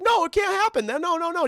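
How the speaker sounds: high frantic protest; panicked denial. Acted. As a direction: deny it in a squeaky panic. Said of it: "He's like".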